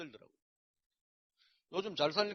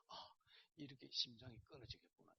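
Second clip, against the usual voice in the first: hushed anguished thought; 3 semitones lower, 15 dB quieter than usual. Retold or imitated. Inner thought while sobbing.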